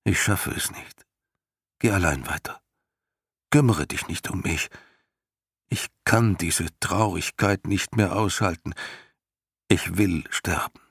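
lightly voiced, tired and hopeless horse; a hint of a despairing horse pleading to turn back; weary horse Artax. A narrator's reading. Horse Artax begs his master to turn back, weary and hopeless.

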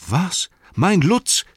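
high, loud astonished exclamation; delighted cry. Acted. Sam hears his pony is back, delighted cry. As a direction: burst out in happy disbelief. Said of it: "rief Sam".

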